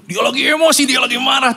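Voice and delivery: mock heated retort. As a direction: raise the voice as if arguing back. Retold confrontation.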